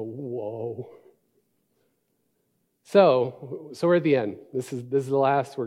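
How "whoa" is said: awed whoa. Retold or imitated. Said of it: "that last part makes me go".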